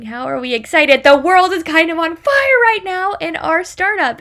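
loud, rising mock disbelief; incredulous. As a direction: protest as if everything is going up in flames. Retold disbelief at colleague's advice.

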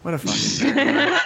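high, drawn-out teasing through laughter; laughing mockery. Mocking the other guy to the group, laughing mockery. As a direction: jeer it slowly in a high voice, laughing.

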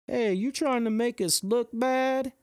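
high jeering question from a stranger; mocking jab. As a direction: needle the runner with a sneer. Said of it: "this random dude's like".

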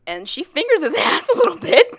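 high, loud, laughing delivery; laughing while talking. Real, not acted. Telling a friend the raunchy part, laughing, high and loud. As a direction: break into laughter as you say it. Giggling over risqué plot detail.

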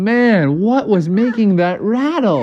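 storybook "oh, man" bafflement; exasperated wondering. Acted. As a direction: wonder aloud with swooping pitch. Characters' dismayed question in story.

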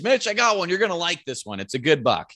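excited high-pitched call to a buddy; retold yell. Hunter calls to his buddy about the buck, yelling. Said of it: "I'm yelling at Mitch".

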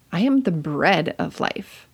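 slow, with "bread" punched out; stress on BREAD. Shown as a demonstration. Teacher showing how emphasis changes a verse, stressing "bread". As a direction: put the weight on "bread". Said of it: "emphasize one different word each time".

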